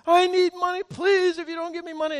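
high, loud begging voice; hammed-up plea for money; pleading stranger. Woman in a restaurant begging pastors for bus money, high.

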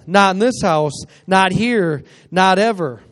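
loud, emphatic preaching; fired up. Real, not acted. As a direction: drive it home loudly, phrase by phrase. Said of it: "I got a little fired up there".

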